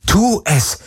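high, urgent inner cry; desperate self-command; inner scream. A narrator's reading. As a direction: urge yourself on, pitched high. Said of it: "schrie alles in ihr".

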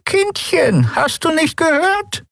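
put-on high, slow croaking old woman; creepy caretaker. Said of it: "krächzte die alte Frau".

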